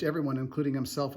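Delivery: ordinary talk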